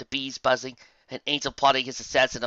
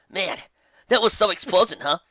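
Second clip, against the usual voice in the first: put-on higher, louder young dragon; a hint of a boy's call; lightly voiced Spike; 7 semitones higher, 6 dB louder than usual.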